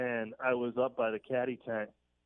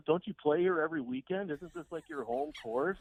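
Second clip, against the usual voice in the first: needling jab, pitched up high; mock ribbing; 7 semitones higher than usual. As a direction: rib him with a high, incredulous question. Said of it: "Just riding him".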